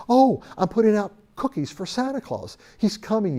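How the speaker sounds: a hint of an eager little kid; little boy voice. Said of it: "Billy says".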